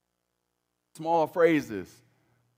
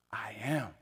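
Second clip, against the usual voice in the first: breathy, awed whisper, much softer; whispered voice of God; 5 semitones lower, 8 dB quieter than usual. Acted. Pastor voices God answering Moses at the bush, whispered. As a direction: whisper it as God's own name.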